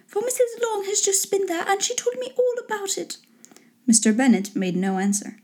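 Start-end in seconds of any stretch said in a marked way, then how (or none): 0.1-3.2 very high, lightly voiced lady; put-on high wife voice; gossiping wife. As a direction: pitch it way up to share the news.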